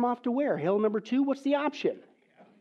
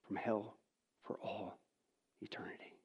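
fading out to a whispered eternity; 11 semitones lower, 15 dB quieter than usual. Real, not acted. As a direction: voice sinks softer to a whisper.

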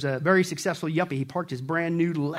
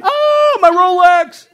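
hammed-up shriek, much higher and louder; screamed punchline; 12 semitones higher, 15 dB louder, much slower than usual. Yuppie wailing over his lost watch, hammed-up scream. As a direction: wail it like losing the most precious thing. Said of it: "To which the yuppie screamed".

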